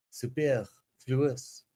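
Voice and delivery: slow, soft stab at French pronunciation; terrible French accent. Shown as a demonstration. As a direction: attempt "Superfluous" with a French accent, quietly drawn out. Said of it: "French pronunciation".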